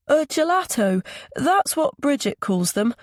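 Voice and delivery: put-on very high child character voice; small boy's high voice. A narrator's reading. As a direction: pipe up like a little boy. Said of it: "Milo's high voice".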